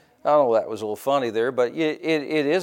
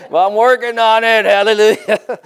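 playful hallelujah shout; exultant comic outburst; 8 semitones higher, 7 dB louder than usual. Real, not acted. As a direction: belt it out like a joking preacher. Playful exclamation to laughing congregation.

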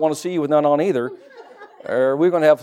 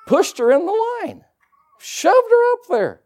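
mock shove; slower than usual. Pushing the woman into the prayer line.

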